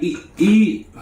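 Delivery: imitating the inmate urging him to eat. Retake after stutter remark.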